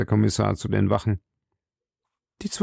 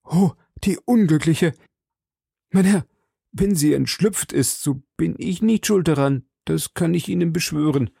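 higher-pitched, protesting suspect; exclaiming Bonacieux; 8 semitones higher than usual. A narrator's reading. Suspect swears to the commissioner he is blameless, protesting. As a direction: cry out, then plead innocence. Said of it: "rief Bonacieux".

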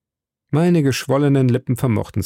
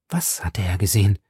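plain reading voice

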